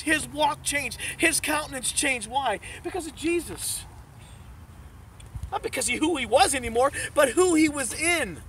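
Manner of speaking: full-force pulpit preaching. Acted. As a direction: drive the message home to the congregation.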